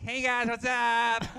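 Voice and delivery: high, drawn-out mock greeting; exaggerated high-pitched impression of a friend; Andrew. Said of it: "talk to Andrew in real life".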